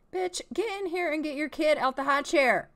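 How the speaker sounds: loud exasperated call; calling out loudly. Real, not acted. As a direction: yell at her to come back. Player yelling at Sim mom.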